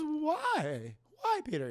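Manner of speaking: high pleading aunt; Aunt May voice. Retold or imitated. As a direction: ask it like a hurt old aunt. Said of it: "That's my Aunt May voice".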